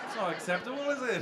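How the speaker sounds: ordinary talk, nothing marked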